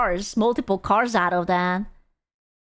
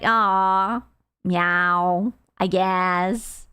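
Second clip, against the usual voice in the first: low, half-hearted meow; resigned lion meowing; 5 semitones lower, much slower than usual. Pretend. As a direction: give in and play house cat, dropped in pitch. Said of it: "And it was just like".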